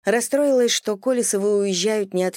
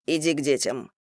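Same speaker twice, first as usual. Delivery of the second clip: тихий приказ; 4 semitones lower than usual. A narrator's reading. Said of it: "приказал".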